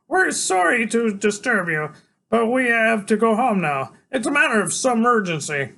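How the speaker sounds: put-on high kid straining for formality; trying to sound grown-up. A narrator's reading. Freak formally asks Iggy to leave, mock grown-up voice. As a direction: apologize like a small boy playing adult. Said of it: "tries to make his voice sound deep and more grown up".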